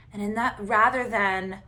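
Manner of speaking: ordinary talk, nothing marked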